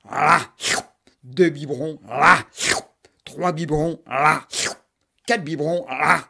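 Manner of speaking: loud, punchy là with each bottle; loud there. Acted. Mother cramming bottles into the monster, loud là.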